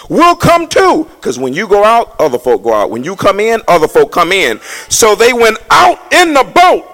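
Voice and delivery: loud, high hollered preaching; preaching at full force. Real, not acted. Pastor to congregation on Peter going fishing, loud preaching. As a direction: holler it at the church.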